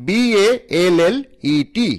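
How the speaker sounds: ordinary delivery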